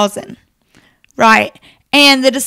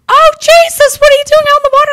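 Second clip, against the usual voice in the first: much higher and louder mock panic; panicked shriek; 7 dB louder, faster than usual. Disciples on the boat cry out to Jesus in mock panic. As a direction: squeal it like frightened men in a storm.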